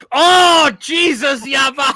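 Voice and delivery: high, very loud cry of horror; shrieked, horrified outburst; appalled shout. Real, not acted. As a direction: yell it in real disgust. Appalled at a food picture.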